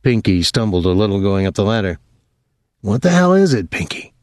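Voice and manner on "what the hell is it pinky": higher-pitched, irritated question; irritable. A narrator's reading. Thomas to Pinky, woken in the night, irritable. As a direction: ask it with sharp irritation, pitch raised. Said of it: "Thomas asked irritably".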